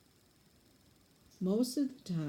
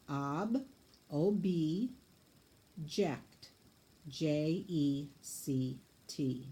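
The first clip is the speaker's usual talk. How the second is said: slow spelling; 2 semitones lower, much slower than usual. Shown as a demonstration. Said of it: "spell on your paper, syllable by syllable".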